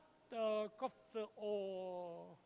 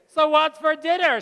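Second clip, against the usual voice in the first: put-on loud, high call of a hungry kid; mock hungry kid shouting for dinner; hungry kid's call; 5 semitones higher, 11 dB louder than usual. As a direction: call out like a kid home starving. If one arrives hungry.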